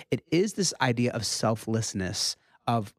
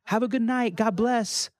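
put-on light, higher kid voice quoting his boy; lightly voiced excited child, higher; imitating his little son; 11 semitones higher than usual. Dad quoting five-year-old son.

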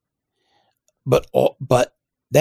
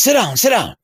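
much higher, eager insistence; Biden impression; 4 dB louder than usual. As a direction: wave him to the seat, full of glee. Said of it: "And he says".

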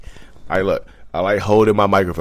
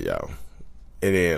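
ordinary talk, nothing marked

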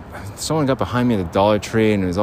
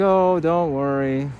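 mock casual; 4 semitones higher than usual. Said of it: "And just casual".

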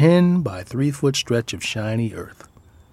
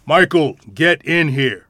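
loud, raised-pitch parental command; authoritative shout; 5 semitones higher, 7 dB louder than usual. Acted. Parent ordering Michael inside at dawn, authoritative shout. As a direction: order the child indoors with full authority. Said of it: "in our most authoritative voice".

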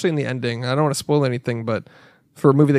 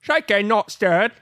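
higher, slow fake Australian accent; mock Australian Bond; 5 semitones higher, slower than usual. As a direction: stretch the broad Australian vowels. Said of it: "That's an Australian Bond".

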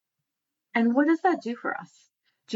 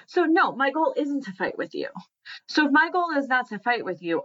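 ordinary talk, nothing marked

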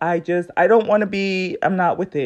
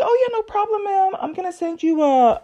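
much higher, sugary customer-service voice; high, chirpy imitation of a friendly agent; super friendly agent; 10 semitones higher than usual. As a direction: play the overly sweet phone agent. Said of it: "super friendly".

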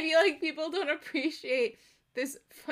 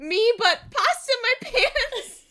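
laughing; 11 semitones higher, 7 dB louder than usual. Real, not acted. Laughing at her own joke.

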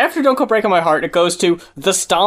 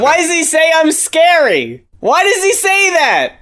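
exasperated disbelief; 9 semitones higher than usual. Real, not acted. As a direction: demand an explanation, pitch way up. Exasperated at the sketch's joke.

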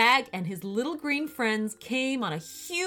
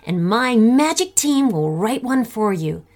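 much louder, lively presenter voice; upbeat host sign-off; 14 dB louder than usual. Real, not acted. Host addressing the gang after story, loud upbeat sign-off. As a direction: send the listeners off with energy.

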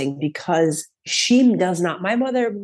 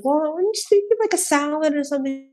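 higher-pitched, hedging reply; tentative suggestion; 9 semitones higher than usual. Retold or imitated. Vegan daughter suggests a dinner to mother, tentative. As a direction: float the idea in a raised, careful voice.

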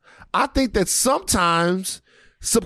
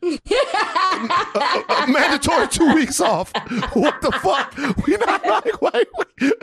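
high-pitched, laughing through the words; laughing rant; 7 semitones higher than usual. Real, not acted. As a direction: crack up while ranting.

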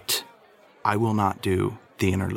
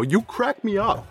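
high, amused delight; high, delighted at the funny face; 11 semitones higher than usual. Real, not acted. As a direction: tell him he cracks you up, high and tickled. Laughing at Chris's face.

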